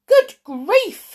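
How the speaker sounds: high, loud startled exclamation; shocked cry. A narrator's reading. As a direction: gasp it out in shock. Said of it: "he cried".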